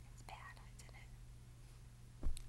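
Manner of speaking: soft slow whisper. Real, not acted. Teacher asking the children, whispered. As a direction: breathe it to the class.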